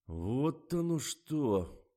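slow, stretched-out realisation; drawn out. A narrator's reading. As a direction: draw every word out slowly. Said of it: "протянул режиссёр". (протяжно, медленно)

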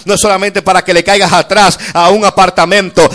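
creciendo en fuerza, cada vez más alto y más fuerte; preaching crescendo. Acted. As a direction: empuja la congregación hacia el clímax. Preaching builds to climax. (predicación que sube con fuerza)

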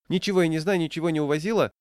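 put-on high, loud old woman's denial in a rush; rapid patter. A narrator's reading. Old woman denies taking the breakfast, rapid patter. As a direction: rattle off a defensive denial. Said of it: "скороговоркой ответила старушка".

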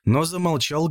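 ordinary delivery

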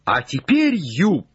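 high, keyed-up call; excited shout. Acted. Legrand cries up to Jupiter, excited shout. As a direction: cry out beside yourself with excitement. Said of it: "закричал Легран вне себя от волнения".

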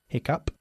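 "Hiccup" said as HIK-up, rhyming with cup; teacher says "Hiccup" slowly, HIK then up; model pronunciation. Shown as a demonstration. Teacher models "Hiccup" for student, said HIK-up. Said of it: "Fíjate cómo se pronuncia".

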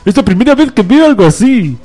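a laughing stab at a Cuban newsreader; Cuban accent attempt. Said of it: "Esto tienes que hacerlo con acento cubano". (acento cubano forzado, más agudo y entre risas)

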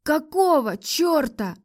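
high, stretched-out cry of disbelief; drawn-out, high-pitched protest; exclaiming. A narrator's reading. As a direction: repeat it in bewildered outrage. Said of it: "воскликнула я".

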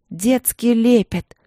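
slow, loud shout through tears. A narrator's reading. Sister shouting at Marina through tears, slow loud cry. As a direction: cry it out, holding back sobs. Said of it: "крикнула она".

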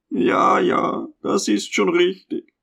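plaintive contentment. A narrator's reading. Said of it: "wehleidiges Behagen zu empfinden".

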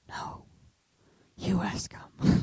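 slow, stubborn whisper; hushed little protest; whispered refusal. Retold or imitated. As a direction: whisper your refusal back, acted out.

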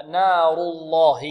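slow "نار الله" with a thick, dark L in "الله"; heavy lam. Shown as a demonstration. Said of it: "تغلظ إذا سبقت بضم". (تغليظ لام "الله" بعد الضم)

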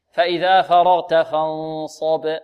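slow recitation, فانصب with held noon and full ص; heavy saad. Shown as a demonstration. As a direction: thicken the ص in فانصب after a long hum. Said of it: "والصاد عندنا ماذا؟". (تلاوة متأنية بتفخيم صاد فانصب)

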